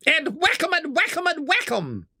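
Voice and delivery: very high, excited Toad; gleeful cry. A narrator's reading. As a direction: chant it in high-pitched fighting glee. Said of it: "cried the toad".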